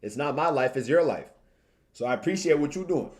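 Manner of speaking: usual speaking voice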